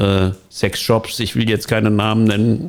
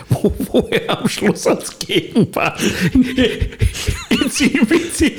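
laughing; 13 semitones higher than usual. Real, not acted. Laughing retelling sketch.

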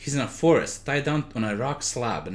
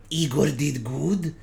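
mindless servant voice; slower than usual. Acted. Servant Igor speaks.

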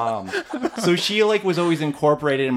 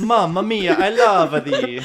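slow, louder hammed-up Italian accent; laughing mock Italian accent, stretched out; 7 semitones higher, 6 dB louder, slower than usual. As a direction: ham it up like a stage Italian, drawing it out.

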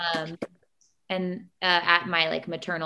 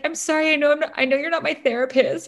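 higher, laughing through half-tears; higher teary-laughing sorry; retold teary apology; 9 semitones higher, 4 dB louder than usual. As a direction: replay the apology with a laughing sob. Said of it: "bawling in her office".

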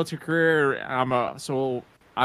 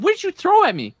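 retold shocked question; 4 dB louder than usual. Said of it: "I said".

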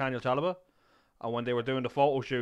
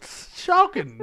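mock outrage; 5 dB louder, much slower than usual.

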